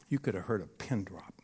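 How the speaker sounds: breathy hushed whisper; whispered aside. Retold or imitated. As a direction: let it drop to a whisper, like the silent room. Hushed room after his question.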